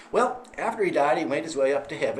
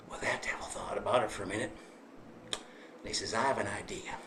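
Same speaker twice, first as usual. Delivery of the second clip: low, soft conspiratorial tone; hushed storytelling; 7 semitones lower, 9 dB quieter than usual. A narrator's reading. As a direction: lean in and whisper it. Hushed narration building suspense.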